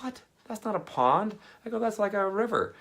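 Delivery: high mock-outraged squeal. Telling the mom it's a river, a high outraged squeal. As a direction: squeal it in comic disbelief.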